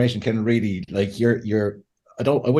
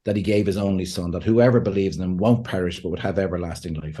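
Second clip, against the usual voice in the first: scripture recitation; 5 semitones lower than usual. Acted. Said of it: "it says".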